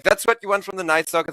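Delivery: ordinary talk, nothing marked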